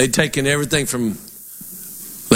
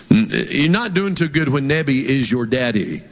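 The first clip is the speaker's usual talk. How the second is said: ordinary talk, nothing marked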